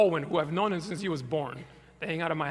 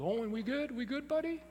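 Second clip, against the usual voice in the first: calling out; 9 semitones higher, 4 dB quieter than usual. Retold or imitated. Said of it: "I yelled".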